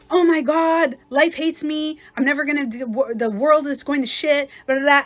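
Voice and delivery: hammed-up despair; dramatic whining. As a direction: wail about life hating you. Acting whiny negative person.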